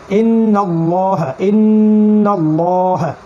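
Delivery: ইন্নাল্লাহা very slow, with a heavy, thick ল. Shown as a demonstration. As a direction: draw out ইন্নাল্লাহা with a full, dark ল. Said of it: "ল পড়লাম".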